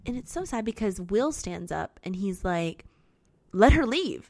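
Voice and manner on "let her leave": louder, higher quoted order; firm acted demand. Will standing up for the girl, loud firm demand. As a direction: step in forcefully on her behalf.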